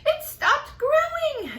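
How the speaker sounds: very high, loud cry; excited cry. Acted. Aunt crying out that the peach stopped. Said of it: "Aunt Spiker cried".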